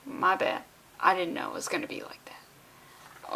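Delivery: soft apologetic whisper; sheepish whisper. Real, not acted. Reader apologizes to the editor, sheepish whisper. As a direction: whisper it as a guilty aside.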